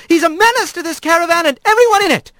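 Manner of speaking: high, fast, indignant outburst; shouted accusation. Acted. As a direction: cry out the accusation as a high-voiced magistrate. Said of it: "as the magistrate shouted".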